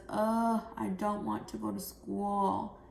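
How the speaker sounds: put-on groaning, unwilling child; sulky, dragging protest; reluctant whine. Shown as a demonstration. Teacher voices Jill not wanting school, reluctant. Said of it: "her voice is going to change".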